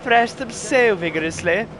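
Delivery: attempted English accent. Gamer mocking a strict nanny agency, attempted English accent. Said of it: "I cannot do the accent".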